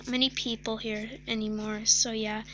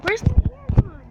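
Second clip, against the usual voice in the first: high, loud shocked cry; 10 semitones higher, 9 dB louder than usual. Real, not acted. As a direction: cry out in disbelief. Shocked at losing hair.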